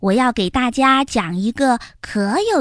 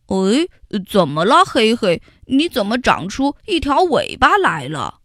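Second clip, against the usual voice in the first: puzzled ant; 2 semitones lower than usual. Acted. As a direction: ask in honest surprise. Xiaoyi surprised at tail. (小易奇怪地问)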